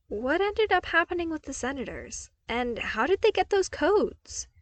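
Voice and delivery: high, eager questions; curious. A narrator's reading. Luke quizzes Vader about the senators, eager and high. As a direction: press him eagerly for answers. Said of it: "still curious".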